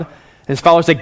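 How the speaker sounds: normal talking voice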